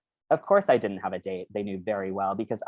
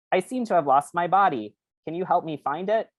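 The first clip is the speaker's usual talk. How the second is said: brain character voice; 8 semitones higher than usual. Acted. Brain character speaks after giggle.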